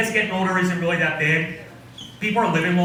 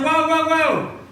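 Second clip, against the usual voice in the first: alarmed whoa; 11 semitones higher, 3 dB louder, slower than usual. Acted. Alarmed at swiping.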